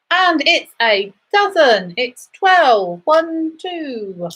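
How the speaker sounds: sing-song number calling, rising then falling; bingo caller. Acted. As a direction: call each number out to the hall.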